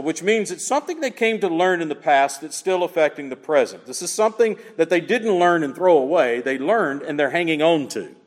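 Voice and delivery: usual speaking voice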